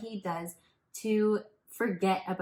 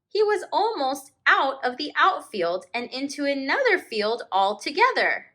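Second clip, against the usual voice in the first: slow, expressive read-aloud with long page-turn pauses; story narration; 7 dB louder, slower than usual. A narrator's reading. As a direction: read it like a teacher sharing a picture book with the class. Teacher reads picture book aloud.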